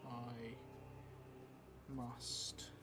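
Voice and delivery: ordinary delivery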